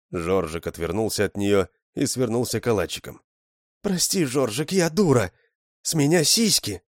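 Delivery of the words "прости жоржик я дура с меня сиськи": put-on higher girlish voice, pleading; a young woman's sorry plea, pitched up; girl apologizing. Lyusya pleading with the dying Zhorzhik, higher girlish voice.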